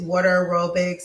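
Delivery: ordinary delivery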